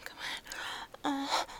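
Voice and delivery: usual speaking voice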